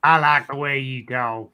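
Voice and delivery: slow Southern drawl; put-on Southern drawl. Ribbing a Southerner about talk, slow Southern drawl. Repeats film drawl in jest.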